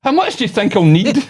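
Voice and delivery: put-on high, loud old-lady question; mock old lady, on the edge of laughter; wee old woman. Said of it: "this wee old woman".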